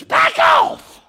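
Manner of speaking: very high, loud sudden holler; shouted order. Retold or imitated. Preacher to those altar workers, shouted order. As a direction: bellow it to clear everyone away.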